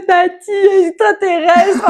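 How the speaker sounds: put-on high, slow scared child calling out; a frightened kid begging auntie; panicked child. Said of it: "je lui fais".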